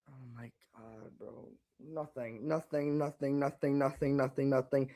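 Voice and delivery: very soft, low, shaken repeating; barely voiced, in shock; stunned murmur. Real, not acted. Fan stunned, player maybe hurt.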